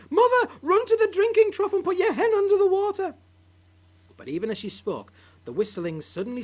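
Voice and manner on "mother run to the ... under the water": high, loud frantic plea; panicked urging. Acted. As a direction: urge her on in panic. Wife urges grandma in panic.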